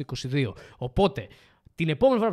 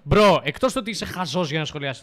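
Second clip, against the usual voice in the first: exasperated calling out; 2 semitones higher, 9 dB louder than usual. Real, not acted. Streamer answering a viewer's jab, loud and exasperated. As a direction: cut in loudly, in real protest.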